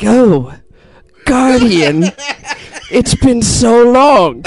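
high, slow villain greeting; the Creator, Kalo impression. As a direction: savor the reunion with an old enemy, drawn out. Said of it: "with the Kalo impression".

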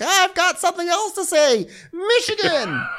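very high mocking voice; mock drunk Terry. Terry barging back into the fight, high and squealing. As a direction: squeal it like a tipsy drama queen. Terry barges over, mocked.

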